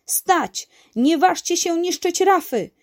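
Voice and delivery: high, loud command; commanding storybook shout; shouting from afar. A narrator's reading. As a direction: call a halt across the distance. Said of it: "wołał z daleka wuj".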